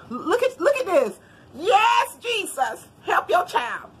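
high, loud burst of praise; overjoyed exclaiming. Real, not acted. Vlogger thanking Jesus over a gift, overjoyed. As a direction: cry out in thrilled thanksgiving.

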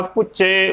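ਪੁੱਚੇ as puchche, no n; missing nasal. Shown as a demonstration. Teacher warning what not to say, ਪੁੱਚੇ without nasal. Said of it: "ਉਚਾਰਨ ਨਹੀਂ ਕਰਨਾ".

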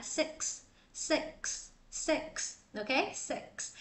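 "six" with k-s released at the end; soft model "six", full hissed k-s ending; natural "six". Shown as a demonstration. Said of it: "cái âm nó bật ra rất là tự nhiên".

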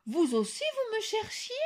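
high surprised question; astonished. A narrator's reading. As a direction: ask in amazement, rising high. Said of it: "s'étonna Loup Blanc".

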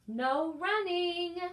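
high, loud, slow call; drawn-out librarian call; calling after. Acted. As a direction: call the rule after him down the hall. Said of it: "Miss Merriweather called after him".

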